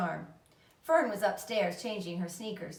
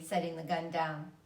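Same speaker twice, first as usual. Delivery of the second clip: usual speaking voice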